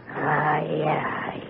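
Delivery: ordinary talk, nothing marked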